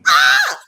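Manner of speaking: high, loud sudden yelp; short, piercing mock shriek; imitated child's scream. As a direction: mimic a kid yelping after a burn. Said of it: "she stood there and went".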